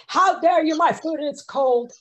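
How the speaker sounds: yelling it flat out, the way not to do it; overdone shout. Shown as a demonstration. Teacher showing the wrong, overblown outrage. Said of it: "you don't say".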